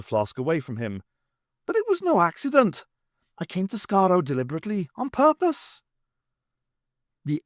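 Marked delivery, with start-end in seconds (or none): 1.7-5.8 high, fast insistent Irishman. Acted. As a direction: protest eagerly, pitched up. Pat insists.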